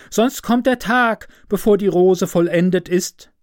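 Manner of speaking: high, pressing call of the rose bush; urgent calling bush. A narrator's reading. Rose bush urging the nightingale to press harder, high urgent call. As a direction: urge the little bird on, pitched high. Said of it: "rief der Strauch".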